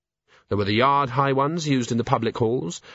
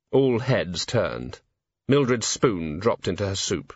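usual speaking voice

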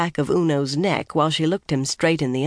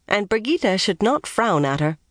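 usual speaking voice

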